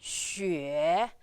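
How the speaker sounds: XUE dipping low, then rising; third tone. Shown as a demonstration. Teacher demonstrating the third tone, long low dip and rise. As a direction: sink deep on xue and come back up. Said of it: "Remember the third tone going down".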